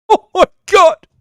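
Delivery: high, loud shriek; high, loud shriek of laughter. Real, not acted. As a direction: squeal it out. Shrieking aside.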